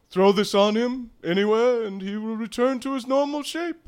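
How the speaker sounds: loud high instruction; character instruction. Acted. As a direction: instruct with flair.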